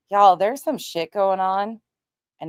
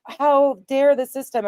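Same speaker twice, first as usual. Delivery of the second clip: imitating upset people, higher pitched; a raised, mock-indignant protest; mock outrage; 6 semitones higher, 4 dB louder than usual. Streamer imitating people upset at the system, mock outrage. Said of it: "so many people were so upset".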